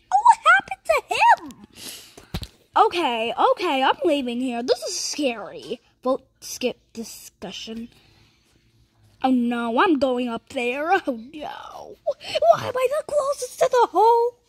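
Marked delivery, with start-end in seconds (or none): 0.1-1.7 very high, loud squeal; shocked. Real, not acted. Startled by what happens in game. 12.1-14.4 high, half-laughing wail; panicked squeal. Real, not acted. Dismayed at danger in the game.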